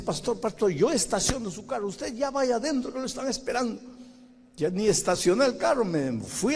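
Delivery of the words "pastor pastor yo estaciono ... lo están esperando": higher, hurried elder's plea; anxious elder. Retold or imitated. Church elder hurrying the late pastor, high urgent voice. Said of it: "un anciano ... me dice".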